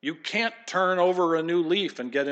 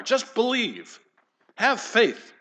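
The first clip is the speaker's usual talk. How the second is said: higher, mocking "Just believe. Have faith."; mock faith preacher; 8 semitones higher, 3 dB louder, slower than usual. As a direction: parody a preacher who sells faith itself. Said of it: "religious preachers that seem to believe".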